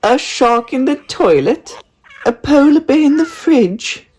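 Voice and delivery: very high silly teasing voice; mocking parents. A narrator's reading. Parents mocking Daisy, very high and silly. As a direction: tease like parents laughing.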